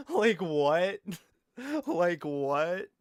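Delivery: cracked up and baffled; laughing disbelief. Real, not acted. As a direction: laugh out your disbelief, loud and high.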